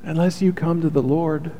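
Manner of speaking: normal talking voice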